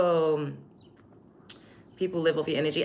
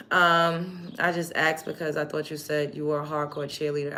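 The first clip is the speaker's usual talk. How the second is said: ordinary talk, nothing marked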